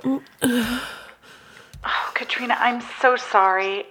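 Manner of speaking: soft whispered apology; whispered sympathy. Acted. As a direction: breathe the apology tenderly. Sympathizing with frightened friend.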